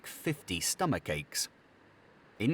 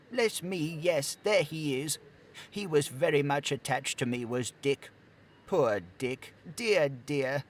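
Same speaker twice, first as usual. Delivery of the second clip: fondly remembering a lost friend; 6 semitones higher than usual. Acted. Scrooge fondly remembers Dick.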